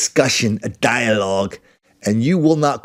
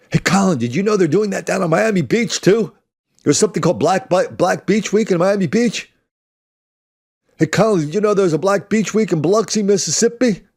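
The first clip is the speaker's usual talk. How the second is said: mock eager listener; 7 semitones higher than usual. Mimics eager listener's messages.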